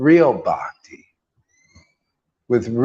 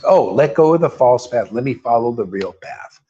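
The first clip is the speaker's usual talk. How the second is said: ordinary talk, nothing marked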